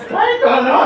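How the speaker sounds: high, loud startled yelp; mock shocked cry. Teacher mimicking a student's shocked outcry, high and loud. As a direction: shriek it like a caught-off-guard student. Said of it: "Sabi mo".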